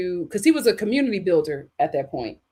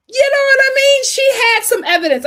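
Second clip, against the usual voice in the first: emphatic exclamation; 9 dB louder than usual. Real, not acted. Emphatic point to the audience.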